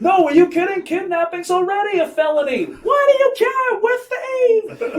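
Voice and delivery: very high, loud mock screaming; hammed-up shouting match between thieves; arguing crooks. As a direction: scream it over a roaring motor. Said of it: "having this conversation like".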